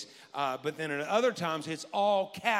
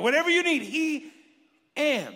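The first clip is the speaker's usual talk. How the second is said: higher, louder, savoring it; repeating the punchline; 8 semitones higher, 7 dB louder than usual. Shown as a demonstration. Pastor repeating a saying he likes, louder and higher. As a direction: say it again with delight. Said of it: "I like it".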